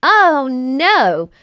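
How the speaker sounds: ordinary delivery, nothing marked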